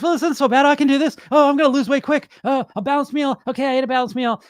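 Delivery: breezy confident dieter in week one; upbeat first week. Retold or imitated. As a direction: play the keen dieter who finds it easy. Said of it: "first week, water fast".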